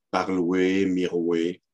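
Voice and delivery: "parloué, miroué" ending in wé, no r; old "oué" ending. Shown as a demonstration. Lecturer listing examples, "miroué" for miroir. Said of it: "le war".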